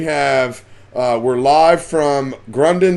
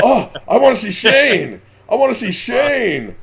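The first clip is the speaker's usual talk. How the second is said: high-pitched mock fan pleading; imitating eager fans; 7 semitones higher than usual. Host mimics everybody wanting to see Shane, mock pleading. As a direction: whine it like a clamoring fan. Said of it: "everybody's like".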